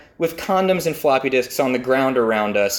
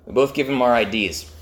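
ordinary talk, nothing marked

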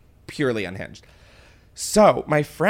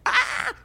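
excited squeal. Retold or imitated. Retold excited scream.

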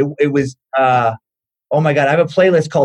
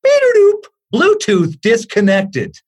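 high beep, then slow device announcement; mock automated disconnect alert; imitated Bluetooth speaker; 6 semitones higher, 3 dB louder, slower than usual. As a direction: mimic an electronic voice prompt. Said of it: "from his drawer he hears".

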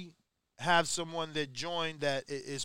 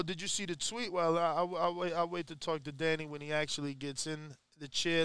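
ordinary delivery

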